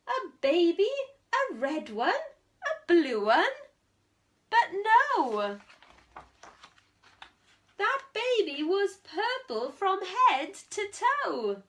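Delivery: put-on storyteller teasing the children with guesses; excited surprise. A narrator's reading. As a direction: build the suspense with mock wonder.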